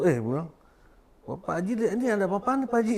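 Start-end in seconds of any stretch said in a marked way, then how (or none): 1.2-2.9 high, startled retold question; surprised neighbour. Neighbour asking Pak Haji what is going on, higher and startled. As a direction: voice a neighbour caught off guard.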